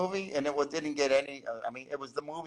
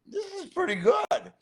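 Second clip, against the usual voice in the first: much higher, pleasantly surprised friend's voice; surprised convert; 12 semitones higher than usual. Retold or imitated. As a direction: sell the surprise that it's actually good. Friend changes his mind, retold.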